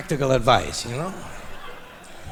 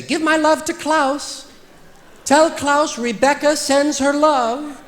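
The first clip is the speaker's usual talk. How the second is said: much higher and slow, sugary; sugary high message; 10 semitones higher, slower than usual. Retold or imitated. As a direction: coo it sweetly in a high voice. Quoting a friend's sweet message.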